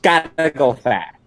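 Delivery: lightly put-on speedy hero catchphrase; meme voice. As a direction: toss off the catchphrase cartoon-style.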